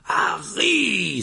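high exclamation, out of breath. A narrator's reading. Mr Weasley finds Harry after running, breathless exclamation. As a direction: cry the name on spent breath. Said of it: "s'exclama Monsieur Weasley hors d'haleine".

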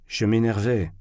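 usual speaking voice

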